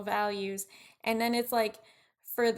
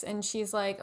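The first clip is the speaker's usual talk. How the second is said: usual speaking voice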